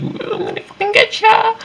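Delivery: shrill squeaky cartoon threat; wild unhinged ranting. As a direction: screech it like a crazed villain. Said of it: "society encroaching on nature".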